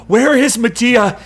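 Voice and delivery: high, loud frantic shout. A narrator's reading. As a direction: call out breathlessly in alarm. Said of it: "he shouts".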